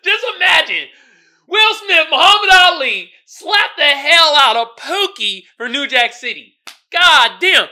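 high, loud disbelieving yell; loud high hype; excited shout. Real, not acted. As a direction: shout it like you can't believe it. Hyped disbelief at the slap.

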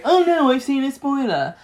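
dramatic alarm. Retold or imitated. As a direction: mimic a dramatic "oh no". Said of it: "you will go".

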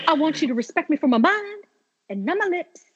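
high, put-on Southern lady voice; Dorothy voice. Fan doing Dorothy's high Southern-lady voice. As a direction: deliver it with prim Southern dignity.

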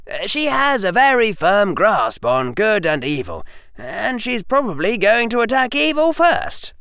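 high, innocent schoolboy; Harry voice. Acted. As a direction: play the innocent.

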